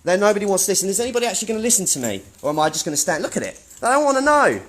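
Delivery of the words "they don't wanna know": calling out. Real, not acted. Calling out at staff who leave.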